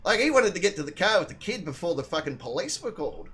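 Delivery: usual speaking voice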